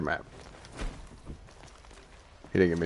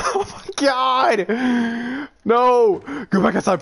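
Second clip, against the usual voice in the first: high, raised cries of dismay; frantic dismay; 10 semitones higher, slower than usual. Real, not acted. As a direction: cry out desperately, voice pitched up. Frantic over losing the animal.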